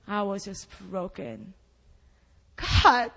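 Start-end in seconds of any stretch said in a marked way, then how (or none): 2.6-3.1 loud exclamation of God's name; angry cry. Retold or imitated. Crying out to God in anger during service. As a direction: call out to God, frustrated. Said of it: "I was, like, angry at him".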